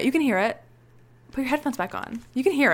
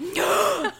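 mock gasp; 9 semitones higher, 4 dB louder than usual. Acting out the scene.

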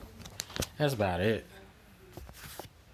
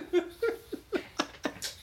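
laugh. Real, not acted. High laugh at own accent.

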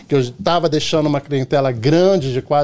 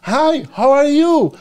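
imitating a taxi driver; 8 semitones higher, 3 dB louder, slower than usual. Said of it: "ele chegou assim e falou assim pra mim".

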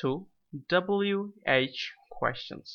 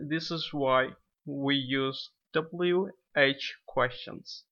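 ordinary talk

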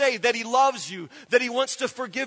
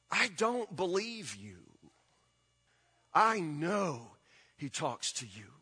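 intimate hush; 6 semitones lower, 8 dB quieter than usual. Real, not acted. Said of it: "preacher drops to intimate tone".

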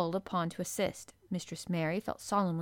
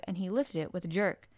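ordinary delivery, nothing marked

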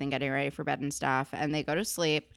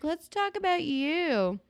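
high, slow coy voice; hammed-up flirt, stretched out; mock flirty; 12 semitones higher, slower than usual. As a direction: purr it up much higher as a tease.

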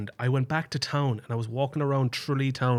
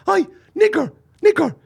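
high loud yelling; imitating him yelling across the street; mock yell; 10 dB louder than usual.

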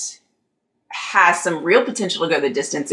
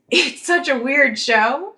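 laughing; 8 semitones higher, slower than usual. Real, not acted. Laughing while saying it.

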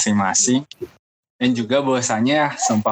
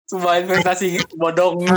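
laughing; 8 semitones higher than usual. Real, not acted. Laughing remark on wasted recorder.

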